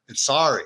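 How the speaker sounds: "sorry" firmly as "SORE-ee", falling at the end; insisting on Canadian "sorry". Retold or imitated. Canadian kid answering back, insisting "sorry" is "sore-ee". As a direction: correct them with a rounded "sore" in "sorry". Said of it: "speaking with a Canadian accent".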